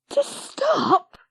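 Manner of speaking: very high, excited squeal; shrieked. Acted. Lizzie hopes the dog will stay, a very high squeal. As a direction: squeal the question in hope. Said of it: "shrieked Lizzie".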